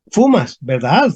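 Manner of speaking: slow fumas with a rising ¿verdad?; confirmation question. Shown as a demonstration. Teacher modelling a confirmation question, slow and rising. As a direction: ask fumas slowly, then lift ¿verdad?. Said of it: "Estoy pidiendo confirmación".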